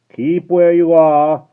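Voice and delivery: loud, high, slow warning; guard warning the rider; calling out. Acted. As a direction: call a hard warning. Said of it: "the guard called to the voice".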